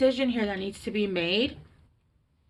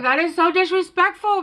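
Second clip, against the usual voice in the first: much louder and higher, hammed-up indignation; mock outrage; 10 semitones higher, 11 dB louder than usual. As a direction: play up the outrage about Harry Potter. Mock outrage at her own thought.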